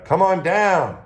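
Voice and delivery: high, loud call down the ship; retold yell. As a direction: call him down from another deck. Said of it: "yells up from the middeck".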